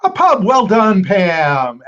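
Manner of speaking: excited. Real, not acted. Host confirms correct answer.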